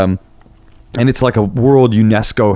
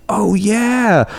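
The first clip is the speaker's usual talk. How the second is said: high, drawn-out oh yeah; mock dawning recognition, pitched up; retold sudden recognition; 11 semitones higher than usual. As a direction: imitate someone suddenly remembering, stretched high. Retold delighted recognition of someone.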